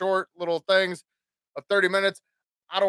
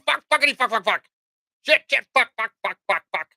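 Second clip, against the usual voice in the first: defiant swearing; 5 semitones higher, faster than usual. Pretend. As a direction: rattle the curses off in defiance. Cussing on show after warning.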